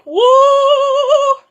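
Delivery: very high, very loud sung whoa; startled whoa. Acted. Police officer shocked by the beast, high loud whoa.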